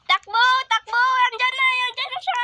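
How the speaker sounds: high frantic yell; panicked shout. Acted. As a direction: cry out in panic. Fleeing pursuer panicked.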